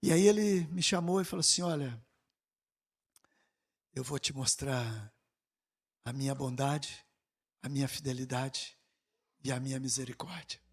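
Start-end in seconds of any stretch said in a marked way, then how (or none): 1.6-10.6 the Lord's voice. Retold or imitated. The Lord speaking in vision.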